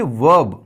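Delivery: वर्ब said "wub", no R; silent R. Shown as a demonstration. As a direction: drop the R in वर्ब. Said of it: "इसमें जो आर है वो silent है". (वर्ब में आर silent)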